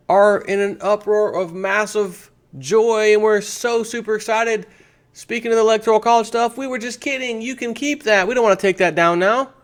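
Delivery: mock gushing liberal. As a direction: ham up a gloating voter backpedalling. Mocking liberals celebrating flip-flop.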